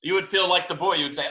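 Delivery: plain reading voice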